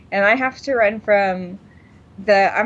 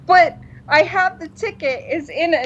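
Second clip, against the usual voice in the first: near tears; 8 semitones higher than usual. Retold or imitated. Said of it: "close to tears".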